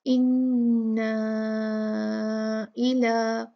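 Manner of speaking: slow recited इन्ना, vowel held long; four-count madd. Shown as a demonstration. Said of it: "चार count पढ़ेंगे". (इन्ना को चार हरकत खींचकर)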